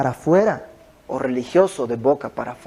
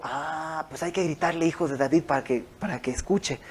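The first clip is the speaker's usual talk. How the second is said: en voz baja, como ocurrencia privada; pensando para sí; 8 dB quieter than usual. Retold or imitated. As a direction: murmura una idea recién descubierta. (hablando bajito para sus adentros)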